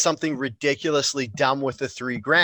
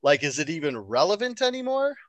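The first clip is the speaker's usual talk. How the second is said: incredulous; 7 semitones higher, 3 dB quieter than usual. Retold or imitated. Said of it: "I was all like".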